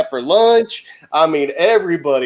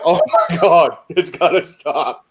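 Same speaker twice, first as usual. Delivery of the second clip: mock dismay; 4 semitones higher than usual. Teller relives panic at the swearing.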